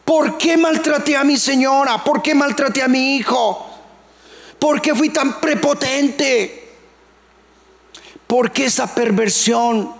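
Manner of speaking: high, pleading self-reproach; anguished cry. Retold or imitated. A sinner crying out in anguish over his own sins. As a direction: cry out in remorse. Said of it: "Y después grito diciendo".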